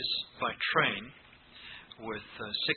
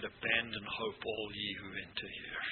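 ominous sign; 4 semitones lower, 4 dB quieter than usual. Pretend. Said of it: "No, it didn't actually say that".

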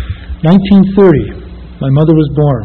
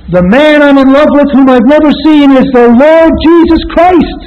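proclaiming; 9 semitones higher, 4 dB louder than usual. Retold or imitated. Proclaiming his love to listeners.

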